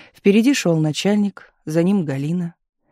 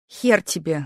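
usual speaking voice

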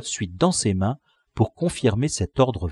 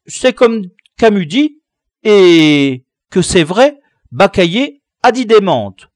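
little ones answering; 6 semitones higher, 8 dB louder than usual. Acted. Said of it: "répondirent invariablement".

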